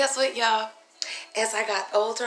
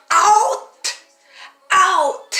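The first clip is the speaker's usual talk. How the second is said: loud, high angry yell; furious teacher shout; 11 semitones higher, 13 dB louder than usual. Retold or imitated. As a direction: throw the kid out of class. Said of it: "He goes".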